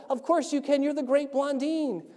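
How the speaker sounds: high, eager reassurance; excited fan. Acted. Picked-out man to his tightrope hero, acted excitement. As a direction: gush with total belief. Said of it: "he's so excited he got picked out".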